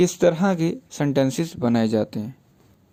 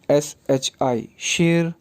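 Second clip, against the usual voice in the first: एस एच आई spelled letter by letter, slow; spelling out slowly; slower than usual. Shown as a demonstration. Teacher spelling syllables apart for the class, slow. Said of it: "अलहदा-अलहदा से pronounce करें".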